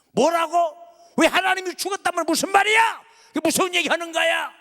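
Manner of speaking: high, loud outraged demand; shocked shout. Acted. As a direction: fire the question back in disbelief. Luther shocked at his wife's words.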